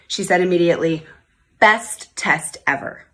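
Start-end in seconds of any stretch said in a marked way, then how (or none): 1.6-3.1 slow, savoured, word by word; proud title. Retold or imitated. Happy story title, slow.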